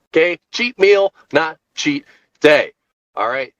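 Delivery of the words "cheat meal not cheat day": "meal" punched, "not cheat day" slow; contrast meal and day. Shown as a demonstration. Drilling viewers on the difference: contrastive stress. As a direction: set "meal" against "day" clearly. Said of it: "Let me emphasize that".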